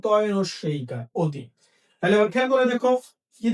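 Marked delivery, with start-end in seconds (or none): 1.2-1.4 অতি said as "o-ti"; closed অ sound. Shown as a demonstration. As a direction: give অতি an initial "o" vowel. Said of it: "এই ওটা আমার অ আর ই এর পরিবর্তে হয়ে গেল".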